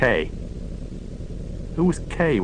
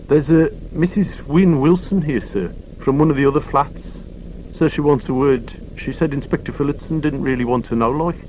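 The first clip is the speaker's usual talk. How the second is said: higher voice with a country lilt; local constable; 5 semitones higher than usual. Acted. Constable reporting a visitor to Morse, higher. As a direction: report it respectfully to the inspector.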